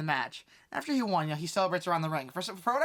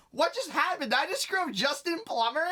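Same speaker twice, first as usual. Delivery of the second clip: high-pitched, startled disbelief; mock shock; 12 semitones higher than usual. Heckler realizing he derailed a speech, high mock shock. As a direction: act out an oh-no realization. Acting out his shocked reaction.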